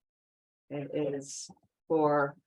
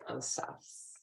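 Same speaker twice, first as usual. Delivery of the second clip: whispered; 3 semitones lower, 13 dB quieter than usual. Shown as a demonstration. Saying the word of power.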